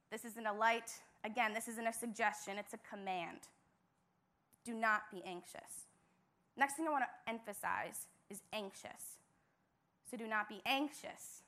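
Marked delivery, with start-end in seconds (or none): none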